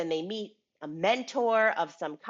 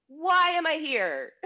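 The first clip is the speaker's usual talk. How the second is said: mock despair; 8 semitones higher, 5 dB louder, slower than usual. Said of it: "roller coaster of emotions".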